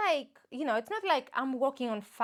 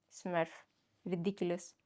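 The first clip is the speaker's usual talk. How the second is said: muttered scorn; 6 semitones lower, 7 dB quieter than usual. Real, not acted. Mocking friends' nickname.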